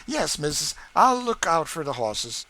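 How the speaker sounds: eager to please; loud quick agreement; Sam obliging. A narrator's reading. Sam promises mistress.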